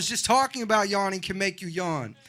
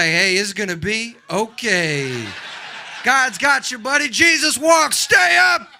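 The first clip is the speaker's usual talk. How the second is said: rousing encouragement; 8 dB louder, slower than usual. Acted. Strangers blessing a sneezer, encouragement building to a shout. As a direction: start slow and loud, climb to a rallying shout.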